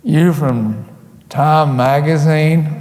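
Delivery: put-on drawn-out Southern accent; slow mock-Southern question; imitated Southern local. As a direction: imitate a suspicious Southern local, stretching the words. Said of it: "And they said".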